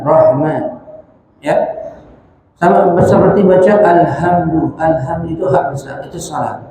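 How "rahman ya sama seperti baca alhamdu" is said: ordinary talk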